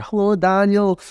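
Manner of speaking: put-on high-pitched little old granny voice; fond imitation of his granny calling out from the back door; granny's wee accent. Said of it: "in her wee accent".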